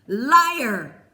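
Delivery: loud furious shout. A narrator's reading. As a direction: hurl the word out. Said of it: "shouted Peter".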